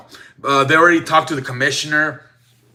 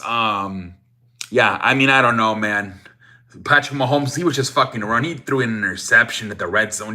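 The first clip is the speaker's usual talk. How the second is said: normal talking voice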